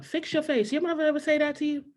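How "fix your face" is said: higher, stern mom scolding; mom's stern line. Retold or imitated. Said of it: "another one she would say all the time".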